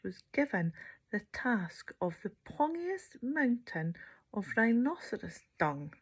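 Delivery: ordinary delivery, nothing marked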